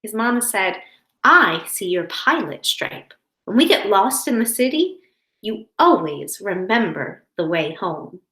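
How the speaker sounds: put-on gentle storybook narration for kids; reading to children. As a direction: read it aloud like to a classroom. Reads picture book to class.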